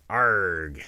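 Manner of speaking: loud pirate growl. Pretend. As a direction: bellow it like a pirate.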